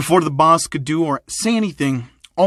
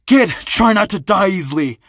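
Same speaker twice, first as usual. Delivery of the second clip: menacing thug boss; 5 semitones higher, 4 dB louder than usual. Acted. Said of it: "would say".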